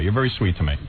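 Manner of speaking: normal talking voice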